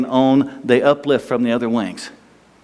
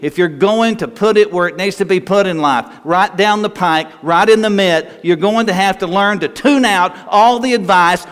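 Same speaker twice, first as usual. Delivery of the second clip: raised, ringing preacher's voice; full-force preaching; 6 semitones higher than usual. Acted. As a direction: drive the exhortation home from the pulpit. Preacher exhorting congregation.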